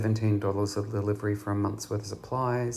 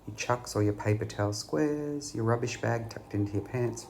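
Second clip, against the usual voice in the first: ordinary talk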